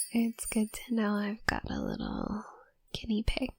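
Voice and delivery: soft playful whisper; teasing whisper. Acted. Potion student teasing her test subject in a soft whisper. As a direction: whisper it like a sly little secret.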